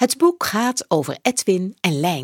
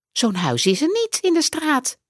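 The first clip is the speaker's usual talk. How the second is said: put-on child voice; 9 semitones higher than usual. A narrator's reading.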